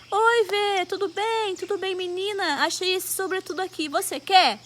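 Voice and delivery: high, friendly imitated greeting; chirpy friend. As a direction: imitate a girl casually offering a gift. Acted girl greeting and offering coat.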